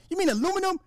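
very high questioning voice; imagined puzzled wife. Pretend. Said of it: "imagine me telling the wife".

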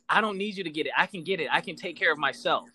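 normal talking voice

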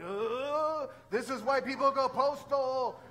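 high, loud, strained cry of exasperation; exasperated outburst. Retold or imitated. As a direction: let the frustration burst out, drawn out. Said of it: "starting to go like".

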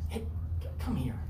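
whispered pull-aside, hey come here; low conspiratorial whisper; hushed aside. Retold or imitated. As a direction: lean in and whisper urgently. Peter pulls Jesus aside.

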